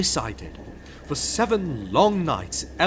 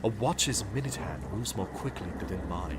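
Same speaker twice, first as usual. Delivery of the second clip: hushed; 8 semitones lower, 7 dB quieter, faster than usual. Acted. Creeping on the eighth night.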